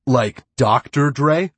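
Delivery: eager, slow, incredulous question; excited. A narrator's reading. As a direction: savor the name with rising delight. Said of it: "excited by her unique name".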